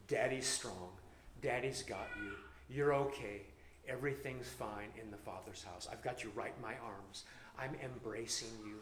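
soft, low reassuring daddy voice; soothing a child. Pretend. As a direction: hush a scared child gently. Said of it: "kind of sing to them".

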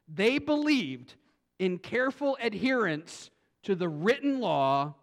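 slow, high, loud build; drawn out for emphasis; emphatic. Real, not acted. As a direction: land the key point. Building emphasis on key point.

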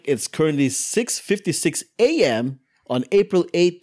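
normal talking voice

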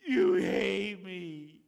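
hammed-up weepy outburst; tearful accusation. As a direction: drag out the hurt. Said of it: "came to him in tears and said".

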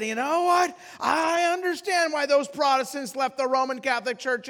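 high, loud proclamation; loud seatmate voice. Retold or imitated. Opinionated passenger lecturing his seatmate, loud and high. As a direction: announce it to the surrounding rows. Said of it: "with that same loud voice".

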